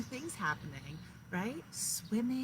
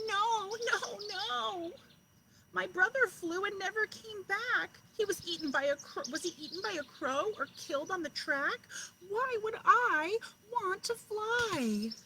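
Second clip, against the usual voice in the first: much higher, louder, pleading protest; crying chick; 10 semitones higher, 5 dB louder than usual. A narrator's reading. Last chick crying no to her father, high and pleading. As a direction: refuse like a frightened child. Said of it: "the last little chick cried".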